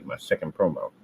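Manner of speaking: ordinary delivery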